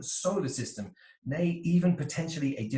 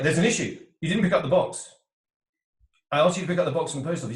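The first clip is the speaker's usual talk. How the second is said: raised, louder complaint about the box; exasperated scolding; 3 semitones higher, 6 dB louder, faster than usual. Retold or imitated. Wolf partner scolding the sheep partner, loud and exasperated. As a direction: nag them about the undone errand, voice up.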